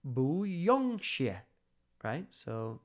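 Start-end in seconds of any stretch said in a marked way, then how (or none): 0.0-1.4 bu yong xie said slowly, pitched up and louder, each tone drawn out; Chinese phrase demo. Shown as a demonstration. Teacher slowly sounding out bu yong xie for learners, high and loud. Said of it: "So this is".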